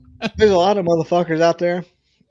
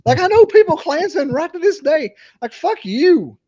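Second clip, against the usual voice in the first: much higher and louder, yelling; shouting rant; 11 semitones higher, 5 dB louder than usual. Real, not acted. Heated drunken rant about Birmingham.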